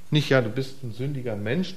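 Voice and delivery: ordinary delivery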